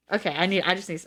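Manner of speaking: plain reading voice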